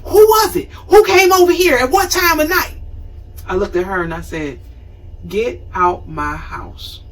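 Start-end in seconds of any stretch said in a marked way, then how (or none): 0.0-2.8 high, loud mock shout of questions; mother screaming. Mother yelling at her grown daughter about night visitors, high loud shout. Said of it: "she was yelling and screaming". 5.3-7.0 slow, soft, steely order; firm dismissal. Retold or imitated. Grown daughter ordering her mother out, slow quiet firmness.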